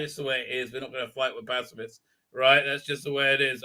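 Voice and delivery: lightly voiced resigned pacifist; resigned Thal leader. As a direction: brush them off with a sigh. Thal leader refusing, low.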